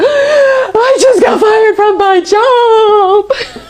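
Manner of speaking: high, slow, sobbing wail half laughing; bursting into tears. Retold or imitated. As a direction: break down crying mid-sentence. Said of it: "And immediately burst into tears".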